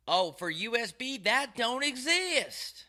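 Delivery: high, drawn-out mocking clerk; mocking store employee. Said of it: "he goes".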